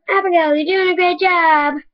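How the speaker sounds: a mild yell of encouragement; crowd yelling. Acted. Crowd yelling to Abigail at the meet, mild high-pitched yell. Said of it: "people yelling".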